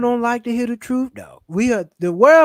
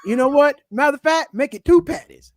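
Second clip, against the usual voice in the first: louder, higher, hammed-up assertiveness; mock indignation; 5 semitones higher, 5 dB louder than usual. Man mistaken for ma'am changing his order, mock indignation. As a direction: order as if proving a point, loudly.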